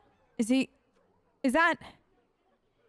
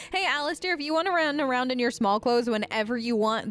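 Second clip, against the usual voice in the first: normal talking voice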